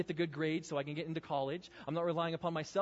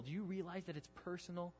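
normal talking voice

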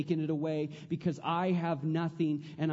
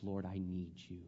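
quiet prayer; 10 semitones lower, 10 dB quieter than usual. Acted. Preacher voicing humble prayer.

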